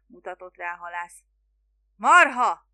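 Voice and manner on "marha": very loud, exasperated outburst; howled insult. A narrator's reading. Spiro losing patience with the fisherman, bellowing. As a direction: explode at a thick-headed listener. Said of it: "üvöltötte Spiro".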